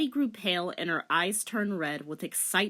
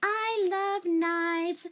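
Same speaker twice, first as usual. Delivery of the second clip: very slow, high, dreamy; creepy slow girl; 12 semitones higher, much slower than usual. Acted. Tripping Rachel to herself, slow and eerie. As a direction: savour each word unsettlingly.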